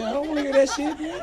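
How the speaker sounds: high, laughing disgusted yelp; hammed-up squeamish son. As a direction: recoil in mock horror. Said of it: "I said".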